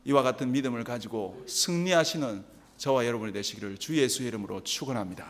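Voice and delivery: low, soft prayerful benediction; solemn blessing. Acted. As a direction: close the sermon with a quiet blessing. Closing benediction.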